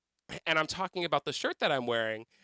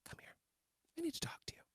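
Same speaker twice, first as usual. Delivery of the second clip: hushed serious summons; 12 semitones higher, 17 dB quieter, much faster than usual. Retold or imitated. Trish pulling her friend aside, hushed and ominous. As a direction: say it hushed, like bad news is coming.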